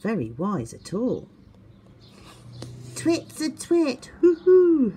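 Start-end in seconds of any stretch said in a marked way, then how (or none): 3.0-4.9 high sing-song taunt, loud hoo-hoo; jeering owl chant; mocking hoot. A narrator's reading. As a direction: tease him like a mean owl brother.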